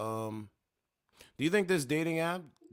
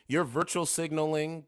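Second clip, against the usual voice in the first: ordinary delivery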